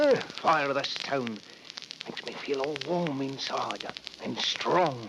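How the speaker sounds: slow, dreamy and hushed; Henry falling under the knife's spell; entranced. Acted. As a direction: spellbound by the stone, drawn out.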